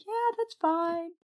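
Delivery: easygoing sing-out, not a care in the world; relaxed, carefree. Retold or imitated. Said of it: "I'm just so relaxed and I don't even care".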